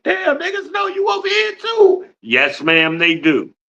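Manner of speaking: ordinary talk, nothing marked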